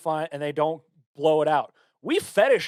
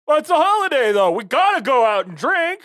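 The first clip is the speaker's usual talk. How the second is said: high, loud mock party-goer; mocking partier voice; 10 semitones higher, 7 dB louder than usual. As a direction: ham up a drunk reveller. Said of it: "The people who are like".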